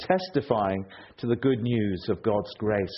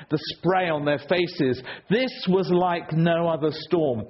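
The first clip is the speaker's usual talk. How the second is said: usual speaking voice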